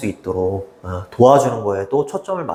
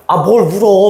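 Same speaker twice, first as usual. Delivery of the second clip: higher and louder, a scolding dad's snap; scolding father; 8 semitones higher, 5 dB louder than usual. Retold or imitated. Dad scolding his crying son, mock annoyed snap. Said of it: "막 다그치는 거야".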